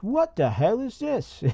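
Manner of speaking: high, drawn-out mock English voice; slow, swooping squeak of a caricature Englishman; Creepy English. Creepy English reaching into his coat pocket, high mock voice. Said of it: "and he's like".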